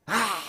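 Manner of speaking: long, high "aah" outburst; acted venting scream; imitated child's yell. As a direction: mimic a frustrated kid hollering. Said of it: "막 애기들 보면 소리 지르고".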